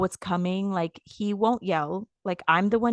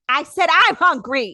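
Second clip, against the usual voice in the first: loud, high mock-angry insistence; irritated outburst; 8 semitones higher, 9 dB louder than usual. Hungry wife telling husband again, irritated mock yell. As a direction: insist loudly, acting out being fed up. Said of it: "I was so irritated".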